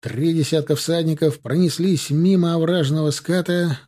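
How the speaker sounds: ordinary delivery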